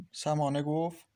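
usual speaking voice

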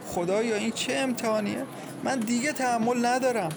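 high, anguished lament to God; despairing. A narrator's reading. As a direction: cry out at the end of endurance. Mother laments to God, beating head.

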